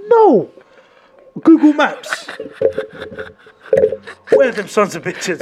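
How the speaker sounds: sudden loud shout; loud outburst. Pretend. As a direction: yell it out, fired up. Imagining revenge for his daughter.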